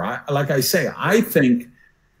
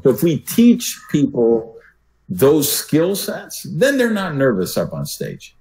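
ordinary talk

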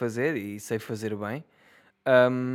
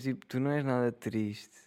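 usual speaking voice

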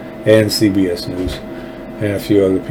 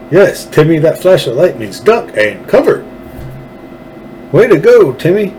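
lightly voiced, higher-pitched fifties instructor; mock fifties safety instructor; 6 semitones higher than usual. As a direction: praise little Timmy like a hammed-up safety film. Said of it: "that shit in the fifties where you said".

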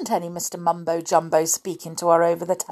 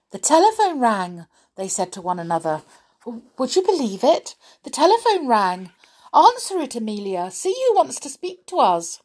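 great surprise; 6 semitones higher, 4 dB louder than usual. A narrator's reading. Stopped singing in great surprise.

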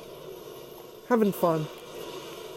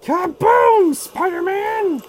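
high, slow taunting villain yell; villain battle cry; 8 semitones higher, slower than usual. Acted. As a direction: bellow it like a gloating supervillain. Streamer voicing villain while playing.

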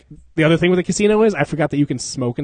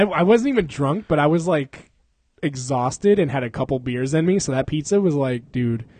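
normal talking voice

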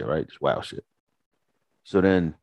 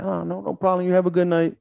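mock gracious; 10 semitones higher than usual.

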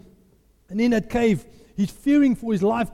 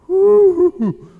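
loud, high "whoo"; high swooping cry; acted exhilarated whoop; 9 semitones higher, 9 dB louder than usual. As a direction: whoop as the soul lifts. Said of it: "my soul goes".

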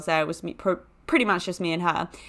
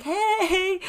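mock-friendly hey, sung out high; high-pitched greeting; 12 semitones higher than usual. Teenager popping up behind the janitor, high-pitched hey. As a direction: draw out a high, cheeky hey.

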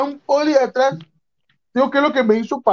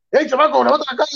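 higher-pitched retold police order; bossy cop; 5 semitones higher, faster than usual. Cop telling the kid to face camera, bossy.

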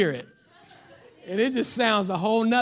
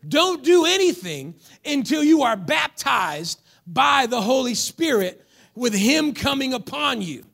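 loud, high fervent preaching; preaching at full force; 5 semitones higher, 5 dB louder than usual. Acted. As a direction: thunder it out like a revival preacher. Preaching with music, raised voice.

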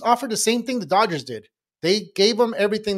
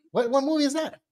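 ordinary talk, nothing marked

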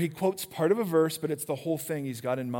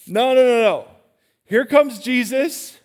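emphatic loud no; 6 semitones higher, 9 dB louder than usual. Real, not acted. Preacher insists loudly to the congregation, emphatic. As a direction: cut them off with a loud, insistent no.

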